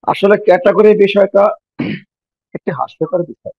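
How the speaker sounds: usual speaking voice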